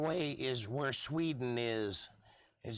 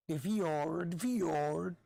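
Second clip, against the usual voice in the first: mock Scandinavian; 4 semitones higher, much slower than usual. Podcaster joking about Norway, in a put-on Scandinavian accent. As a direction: lean into the fjord in a mock Norwegian accent. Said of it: "That was racist".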